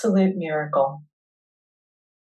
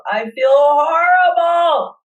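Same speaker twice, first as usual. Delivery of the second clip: yelling it out; 6 semitones higher than usual. Real, not acted. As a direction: let the pain out in a yell. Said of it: "if you need to yell or moan, let it out".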